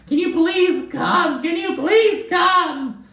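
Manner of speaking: very high, slow, pleading whine; mock begging. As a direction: beg desperately in a hammed-up voice. [name] begging her friends to come.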